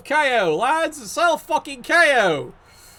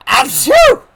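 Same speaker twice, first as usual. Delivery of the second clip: loud mock sneeze; fake sneeze; 12 semitones higher, 12 dB louder than usual. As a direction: sneeze it big and hammy. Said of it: "I'm allergic".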